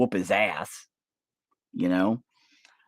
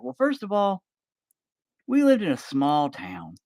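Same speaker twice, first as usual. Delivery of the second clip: joking grandpa; 11 semitones higher than usual. Pretend. Grandpa telling granddaughter how he met Meemaw, high joking old man voice. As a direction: play the old man's deadpan joke.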